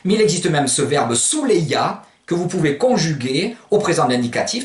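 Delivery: ordinary talk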